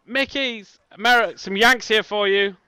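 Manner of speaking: high, drawn-out call to someone in back; shouting into offices. Acted. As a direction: holler slowly through to the back office. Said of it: "and just shout".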